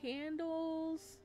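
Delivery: normal talking voice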